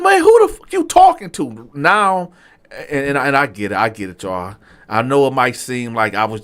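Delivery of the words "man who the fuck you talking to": high, loud furious demand; angry challenge. Retold or imitated. Diner squaring up on the waiter, high and furious. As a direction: get in his face with it.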